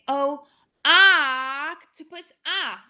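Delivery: slow "Oh, octopus" with a dropped jaw; teacher models the short o; stretched short o. Shown as a demonstration. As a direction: slowly draw out the o. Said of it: "my chin drops really big".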